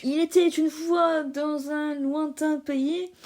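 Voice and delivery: slow once-upon-a-time storyteller; fairy-tale narration. Shown as a demonstration. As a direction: draw it out like a bedtime tale. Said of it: "je vais vous résumer".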